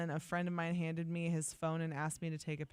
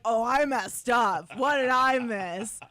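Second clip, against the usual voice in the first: loud, high, slow wail; mock panic; 5 semitones higher, 10 dB louder, slower than usual. Host reacting to a dreaded email phrase, panicked. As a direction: wail in exaggerated dismay at a slip-up. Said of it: "that one makes me feel like".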